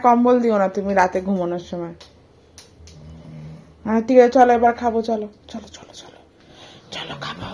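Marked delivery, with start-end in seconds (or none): 5.5-7.5 breathy unvoiced chalo chalo; whisper. Mother whispers to coax her baby to eat. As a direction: hush it to the baby. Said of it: "কানে কানে কথা বলবো".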